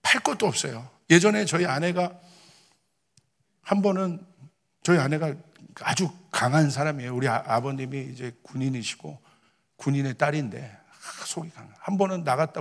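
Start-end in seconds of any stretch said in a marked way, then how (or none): none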